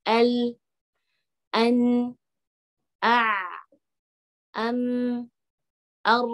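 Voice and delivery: "al, an, a, am, ar", each final sound held moderately; medium-held letters. Shown as a demonstration. Teacher demonstrates the five tawasut letters, medium hold. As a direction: let each final consonant ring briefly, neither cut nor dragged. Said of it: "Tapi tengah-tengah".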